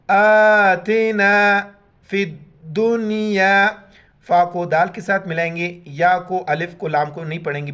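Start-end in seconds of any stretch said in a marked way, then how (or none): 0.1-1.6 आतिना with the opening a stretched one alif long, slow; long first vowel. Shown as a demonstration. Teacher showing the stretched alif, slow drawn recitation. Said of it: "एक अलिफ इधर खींचना है".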